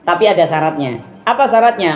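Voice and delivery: ordinary delivery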